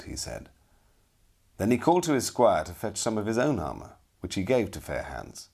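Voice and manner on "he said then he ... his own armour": ordinary delivery, nothing marked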